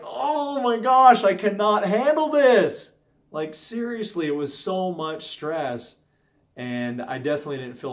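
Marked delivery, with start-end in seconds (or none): 0.0-2.9 high, loud exasperated cry, slow on "Oh my gosh"; the stressed parent at breaking point; overwhelmed outburst. Retold or imitated. Parent overwhelmed by screaming child.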